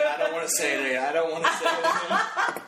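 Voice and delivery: teasing imitation of a coy refusal; mock reluctance. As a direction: mimic her reluctance, pitch climbing and drawn out on the repeat. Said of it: "Earlier she's like".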